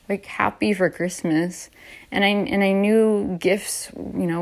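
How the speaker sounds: normal talking voice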